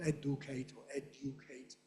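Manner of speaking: educate first as ED-oo-cate, then as ED-you-cate; quiet demo of educate with full middle vowels; non-majority educate. Shown as a demonstration. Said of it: "It's the majority pronunciation, not".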